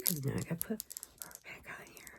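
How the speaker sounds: fast whisper to herself; whispered aside. Real, not acted. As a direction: whisper it quickly, half to yourself.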